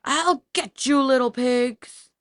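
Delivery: high, loud, slow furious shout; angry threat. A narrator's reading. Angry wolf shouting up at the escaping pigs. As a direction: shake a fist and bellow it.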